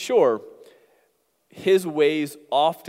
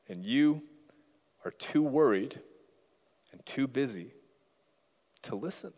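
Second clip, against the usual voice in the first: unhurried, long pauses between phrases; imitating a slow-talking coworker's gentle pauses; slow talker; 3 dB quieter than usual. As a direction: let each phrase land, growing softer. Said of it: "so he talked slowly".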